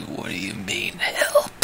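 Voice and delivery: very soft, voiceless challenge; menacing whisper. Acted. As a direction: whisper it low-key, as a wary threat.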